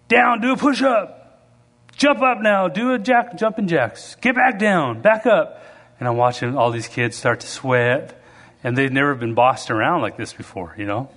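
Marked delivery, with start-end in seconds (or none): 0.1-5.6 coach barking orders. Retold or imitated. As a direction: bark the drills at little kids. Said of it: "the coach is".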